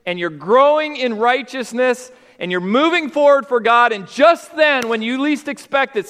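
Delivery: high, loud preaching that keeps climbing; preaching at full force. Acted. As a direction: build the sermon to a passionate peak. Preaching with rising intensity.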